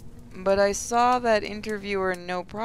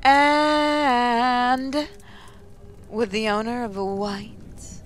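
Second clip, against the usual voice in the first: morning announcer. Acted. Mindy in the Morning addressing the students, announcer delivery. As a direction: stretch the openings like a PA host.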